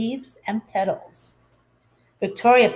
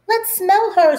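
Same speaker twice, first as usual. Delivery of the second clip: high, loud teasing; taunting classmate, high and loud; mocking child; 9 semitones higher, 5 dB louder than usual. A narrator's reading. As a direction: jeer it at the new girl. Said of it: "said Joe".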